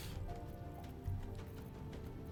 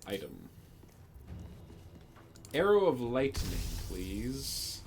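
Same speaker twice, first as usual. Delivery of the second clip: ordinary talk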